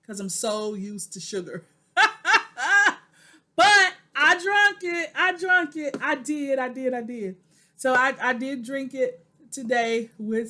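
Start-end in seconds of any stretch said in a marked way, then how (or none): 1.9-3.0 high loud burst of laughter; sheepish laughing burst. Real, not acted. Laughs about Splenda habit. 3.6-7.4 higher and louder, laughing through the words, slowing down; giggly "I did, I did", fading back to normal; laughing confession. Real, not acted.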